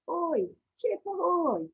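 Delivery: high, loud, slow hail; sailor's shout. Acted. Teacher recalling the sailor's call, loud drawn-out shout. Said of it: "Can you shout that with me?".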